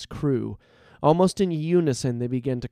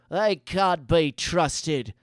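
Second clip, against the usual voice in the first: slow, higher character voice; gang member; 4 semitones higher, much slower than usual. A narrator's reading. Another outlaw backs up the old mage, slow and higher. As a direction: chime in as one of the gang, drawn out. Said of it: "said another in the gang".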